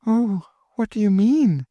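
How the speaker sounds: put-on high, alarmed girlish question; a scared girl pressing her; frightened.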